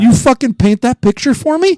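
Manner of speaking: high, loud incredulous demand; baffled, pleading for an explanation; exasperated disbelief. Real, not acted. Host demanding how the cohost got his plate.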